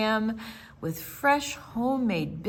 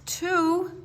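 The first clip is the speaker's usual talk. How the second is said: ordinary delivery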